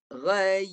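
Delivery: "Ghai" firm and loud, y clearly closed. Shown as a demonstration. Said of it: "tu baru mati".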